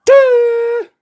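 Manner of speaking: high, loud derisive duh; a loud jeering duh; mocking duh. Mocking the audience.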